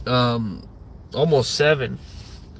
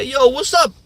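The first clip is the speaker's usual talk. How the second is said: mock casual greeting; 8 semitones higher than usual.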